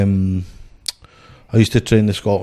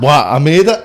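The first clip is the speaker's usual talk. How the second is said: laughing retort; 5 semitones higher, 5 dB louder than usual. Retold or imitated. Retold reply, laughing.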